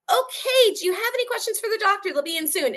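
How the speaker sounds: high, fast and loud flustered nurse; embarrassed nurse hurrying out of the room, high and fast. Retold or imitated. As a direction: rush the words out, embarrassed. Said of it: "watched all the color drain from her face".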